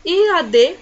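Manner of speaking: usual speaking voice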